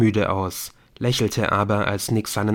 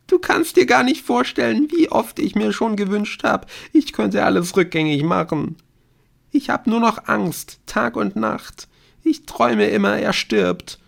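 put-on high, tearful girl's voice; anguished confession; 13 semitones higher, 4 dB louder than usual. A narrator's reading. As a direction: confess your dread, close to hysteria. Girl confesses her constant fear.

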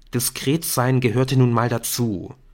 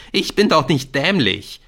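higher, snorted indignant retort; 5 semitones higher, 5 dB louder than usual. A narrator's reading. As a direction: snort it, mildly affronted. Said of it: "schnaubte Jamie". (empört schnaubend)

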